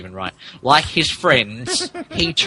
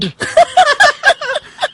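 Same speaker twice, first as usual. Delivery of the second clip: a loud shrieking laugh; loud high laugh; 7 dB louder than usual. Real, not acted. Reader shrieking at the misspelled name.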